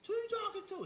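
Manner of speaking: put-on high, rising scold from an old woman; mock old woman telling off a kid. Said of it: "This old woman was like".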